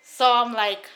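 ordinary delivery

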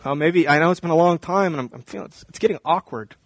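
softly feigned awkward concern; mock them with hushed, embarrassed sympathy. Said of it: "Just totally mocking them".

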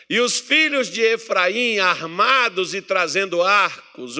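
loud, slow, declaimed verse; preacher's scripture reading. Real, not acted. Preacher reading the Psalm to the church, loudly declaiming. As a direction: proclaim the verse with force, slowly. Reads verse aloud at full force.

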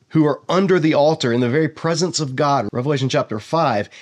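normal talking voice

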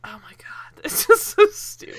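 breathy disbelieving whisper, half laughing; exasperated whisper. Real, not acted. Co-host groaning at the show's plot in a whisper. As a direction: let it out under your breath, amused and fed up. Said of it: "exasperated whisper".

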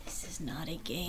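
whispered warning. Acted. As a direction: whisper it eerily. Whispered spooky line.

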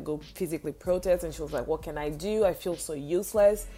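ordinary delivery

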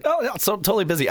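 high, quick, overly busy act; breezy put-on phone chatter; fake phone call. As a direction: pretend you're busy on a call. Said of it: "pretended to be on the phone".

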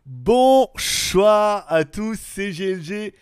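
loud, drawn-out show opening; announcer greeting. Acted.

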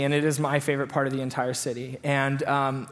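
ordinary talk

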